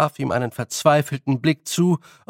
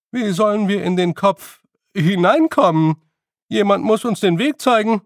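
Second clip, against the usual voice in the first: loud high dopey fairy; dim Feegle; 7 semitones higher, 6 dB louder than usual. A narrator's reading. Wully asking Rob, loud dim Feegle.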